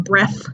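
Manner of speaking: "breath" pronounced breff. Shown as a demonstration. Teacher giving an example word, "breath" as breff. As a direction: end "breath" on an F. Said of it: "comes out as an F".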